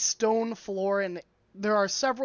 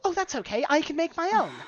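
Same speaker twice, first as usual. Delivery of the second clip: high, light reassurance; obliging dwarf shopkeeper; 8 semitones higher, 4 dB louder, faster than usual. Acted. Dwarf tailor reassuring a customer about measurements, obliging. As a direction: chirp it high and helpfully. Game master voices shop dwarf.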